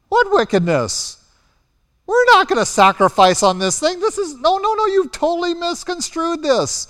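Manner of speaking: high, protesting no no no; frantic denial, voice jumps up high; high-pitched panicked protest; pleading denial. Retold or imitated. Tribes protesting accusation.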